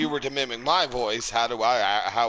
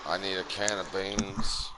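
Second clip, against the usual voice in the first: Undertaker voice; 5 semitones lower, 6 dB quieter than usual. Acted. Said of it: "impersonate Taker".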